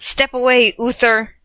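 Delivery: loud warning yell. Acted. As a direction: yell it across the room. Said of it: "Merlin yelled from behind".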